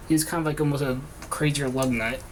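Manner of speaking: normal talking voice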